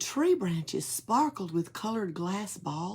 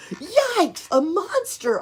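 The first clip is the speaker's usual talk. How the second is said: much higher, louder panicked shriek; frightened yell; 13 semitones higher, 8 dB louder, slower than usual. Acted. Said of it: "Oscar yelled".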